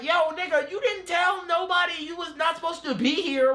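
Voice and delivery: exasperated shout. Retold or imitated. Scolding Abra for sneaking them in, exasperated shout. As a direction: yell it at him, fed up. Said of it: "I'm like".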